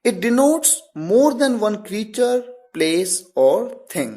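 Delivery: very slow, word by word English definition; teacher dictating a definition, very slow; slow dictation. Shown as a demonstration. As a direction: spell it out slowly for the class to copy. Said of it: "होता क्या है?".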